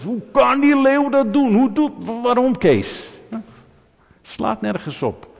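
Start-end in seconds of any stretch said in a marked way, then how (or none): 0.0-3.1 high, loud mock indignation; verontwaardigd uitroepen. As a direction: cry out in hammed-up disbelief. Mock outrage at a lion. (hoog en luid gespeelde verontwaardiging)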